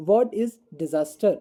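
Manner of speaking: very slow, spaced out question; slow read question. Shown as a demonstration. Teacher reading the first question to children, very slow. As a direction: read the question slowly to the class. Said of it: "first question is".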